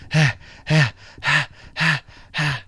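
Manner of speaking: lightly voiced panting dog laugh; happy dog panting. Voicing the dog after its adventure, light panting. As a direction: pant like a thrilled dog.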